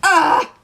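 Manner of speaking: sudden loud, high squeal; excited scream. Real, not acted. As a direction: let out an overwhelmed yelp. Sudden outburst while fangirling.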